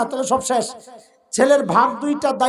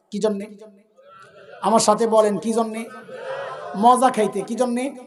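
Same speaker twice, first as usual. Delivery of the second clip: ordinary delivery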